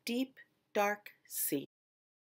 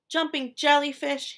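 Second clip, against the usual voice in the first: higher, louder startled cry; cried out; 5 semitones higher, 5 dB louder than usual. A narrator's reading. Fish crying out after bumping his nose. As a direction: yelp it after the crash. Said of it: "he cried".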